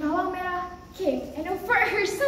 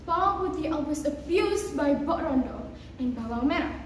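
ordinary delivery, nothing marked

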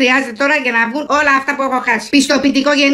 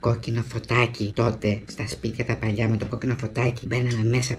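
soft, slow, low confiding voice; patient slowly confessing his youthful brothel visits; hushed confession; 13 semitones lower, 8 dB quieter, slower than usual. Acted. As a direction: lean in and confess it quietly, slowly.

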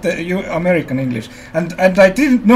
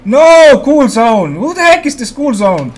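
loud, higher excited yelling at a teammate; shouting; 3 semitones higher, 5 dB louder than usual. Real, not acted. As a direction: yell it across the game chat. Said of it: "Stop shouting".

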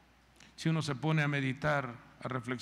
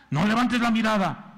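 controlling husband; 4 semitones higher, 7 dB louder than usual. Retold or imitated.

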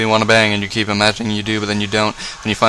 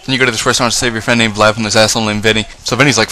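ordinary talk, nothing marked

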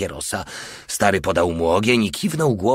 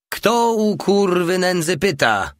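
much higher, slow, strange jeering voice; rude voice from the dark; 10 semitones higher, slower than usual. A narrator's reading. Porta from the dark house to the lieutenant, jeering. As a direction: call out insolently from hiding. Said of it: "głos z mroku domu".

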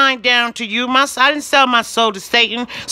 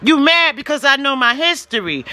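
ordinary delivery

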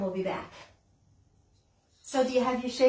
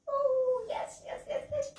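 very high, squeaky yes yes; joyful squeal; 13 semitones higher than usual. Acted. Librarian squeals with joy at finding the kitten.

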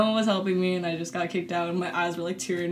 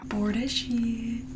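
whispering; 2 semitones higher, 6 dB quieter than usual. Real, not acted. Whispering while eating together.

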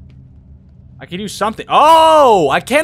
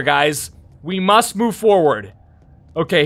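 ordinary delivery

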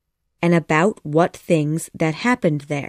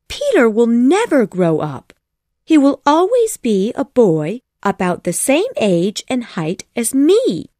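put-on higher, earnest little girl's voice; insistent young Wendy; 5 semitones higher, 4 dB louder than usual. A narrator's reading. Wendy defends Peter Pan to her mom, high and insistent. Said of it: "cried Wendy".

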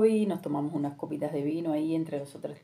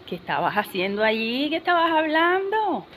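sing-song probing, climbing much higher at the end; mock nosy questioning; 6 semitones higher than usual. Friends quiz her about the man at the buffet, teasingly nosy. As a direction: imitate friends prying, stretching the last question upward.

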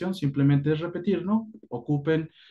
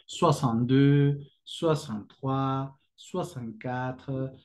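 contar despacio, apagándose; slow fading count; slower than usual. Shown as a demonstration. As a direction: cuenta soixante-deux a soixante-quatre cada vez más bajo. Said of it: "counting demo". (conteo lento que se apaga)

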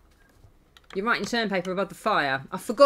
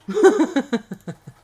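loud, high laugh; 9 semitones higher, 9 dB louder than usual. Real, not acted. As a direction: burst into laughter after a slip. Laughs at the game.

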